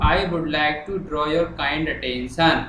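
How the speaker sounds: very slow, word by word; slow dictation. Shown as a demonstration. Teacher writing the letter on the board, slow dictation. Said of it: "अब मैं लिखना शुरू कर रहा हूँ".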